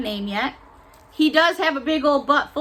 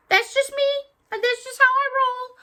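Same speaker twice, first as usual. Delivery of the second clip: much higher, playful sing-song; high sassy aside; 12 semitones higher than usual. Real, not acted. Telling viewers her style in a high sassy voice. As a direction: toss it off cheekily.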